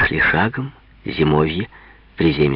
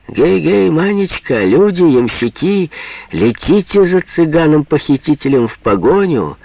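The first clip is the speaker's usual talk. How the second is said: hearty shout; 8 semitones higher, 4 dB louder than usual. Acted. Deacon shouting from the troika.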